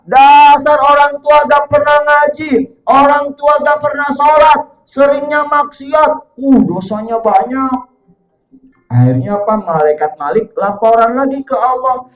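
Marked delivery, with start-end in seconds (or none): 0.0-7.9 seru keras. Acted. Orang tua disiksa di neraka.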